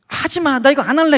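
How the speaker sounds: high, loud protest pushing it away; a sick boy yelling no, stop it; refusing cry. Retold or imitated. Refusing while lying sick.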